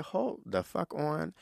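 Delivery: ordinary talk, nothing marked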